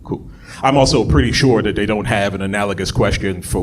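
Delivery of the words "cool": whispered, trailing "cool, cool, cool". Pretend. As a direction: let the "cool" fade to a whisper. Fading resigned repetition.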